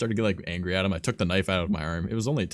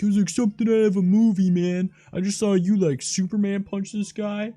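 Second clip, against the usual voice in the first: much higher, louder, hyped-up buddy voice; imitating his buddy; 13 semitones higher, 8 dB louder than usual. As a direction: gush about it like he just saw a movie. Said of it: "he was like".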